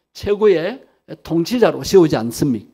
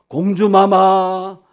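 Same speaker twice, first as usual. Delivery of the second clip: courtier's address; 6 dB louder, much slower than usual. Acted. Mimics people addressing royal children.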